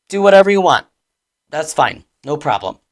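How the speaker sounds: loud fake permission, easing off; tense, dismissive mock consent; sarcastic. Shown as a demonstration. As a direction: act fine with it while resentment leaks through. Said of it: "but the tone carries tension".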